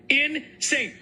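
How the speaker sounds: outraged echo of Insane; stress on Insane. Real, not acted. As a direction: hammer the word Insane.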